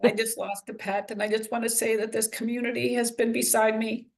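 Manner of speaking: grieving pet owner thanking the community, holding back tears; fighting back tears. Real, not acted. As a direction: thank everyone while trying not to cry. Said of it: "I'm gonna try not to cry".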